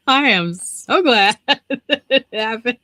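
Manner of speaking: slow, laughing through the words; laughing relief. Retold or imitated. Patient glad she missed the fire alarm, laughing. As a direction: break up mid-sentence with relieved giggles.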